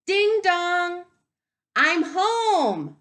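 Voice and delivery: loud, high doorbell and call home. Acted. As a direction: ring out like arriving at the door. Doorbell and dad calling.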